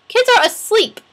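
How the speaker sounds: high, loud exasperated protest; indignant, high-pitched comeback; exasperated retort. Retold or imitated. Arguing back at the mentor's claim.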